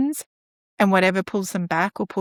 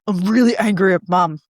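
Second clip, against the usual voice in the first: louder, lightly voiced grumpy child; child complaining about mum; mock angry kid; 4 dB louder than usual. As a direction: play the cross kid. Child answers counsellor.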